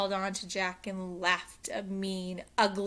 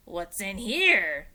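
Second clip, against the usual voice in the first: gruff pirate question; 8 dB louder, slower than usual. Acted. Captain demands.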